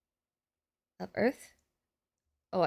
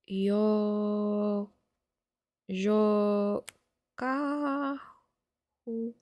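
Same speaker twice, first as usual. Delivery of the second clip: syllable by syllable; 4 semitones lower, 9 dB quieter, much slower than usual. Real, not acted. Sounding out a name for notes.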